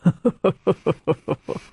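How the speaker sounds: loud, high burst of real laughter; hearty laugh. Real, not acted. Amused after reading poem.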